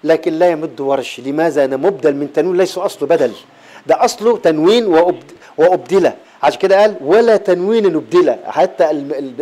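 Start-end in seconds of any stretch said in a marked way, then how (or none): none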